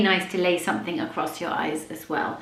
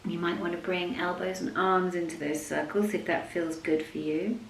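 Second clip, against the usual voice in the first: soft, unhurried yoga nidra voice with long pauses; guided meditation; 2 semitones lower, 11 dB quieter than usual. Acted. Leads a yoga nidra practice.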